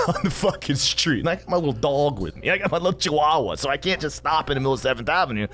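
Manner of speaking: usual speaking voice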